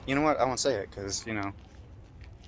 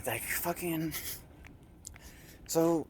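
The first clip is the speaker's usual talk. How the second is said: ordinary talk, nothing marked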